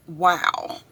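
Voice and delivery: ordinary talk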